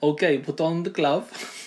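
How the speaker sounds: lightly hammed-up German accent; attempted German accent. Co-host asked to do German, attempted German accent. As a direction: try out a jokey German accent. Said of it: "No, do your German".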